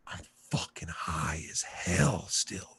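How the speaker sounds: slow, unvoiced dazed whisper; stunned whisper. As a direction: breathe it out slowly, still baked. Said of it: "I'm like".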